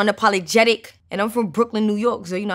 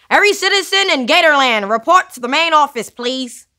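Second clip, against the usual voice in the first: higher, louder hammed-up PA announcement; mock announcer; 5 semitones higher, 6 dB louder than usual. Rapper pretends to page Gatorland citizens, mock announcer voice. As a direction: summon every citizen like a tannoy call.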